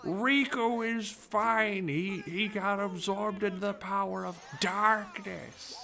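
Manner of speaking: high, slow squawking parrot voice running through the streets; Gilbert Gottfried. Retold or imitated. Voice actor blurting spoilers through the Japanese streets, high and slow. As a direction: shriek the spoilers out like a loudmouth parrot. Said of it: "my best Gilbert Gottfried impression".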